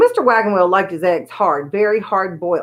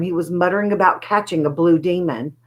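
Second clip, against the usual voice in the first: ordinary delivery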